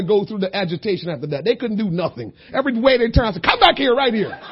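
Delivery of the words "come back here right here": snappy. Retold or imitated. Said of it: "I just got snappy with them".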